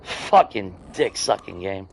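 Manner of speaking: slow low seething curse; bitter mutter. Real, not acted. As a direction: grind it out under your breath. Raging after unfair death.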